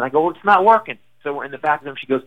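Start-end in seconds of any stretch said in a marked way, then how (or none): 0.2-1.0 higher, louder mock yell; retold yell. Comic retells arguing with wife backstage, mock yell. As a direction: fire it back at her. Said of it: "Don't yell at me".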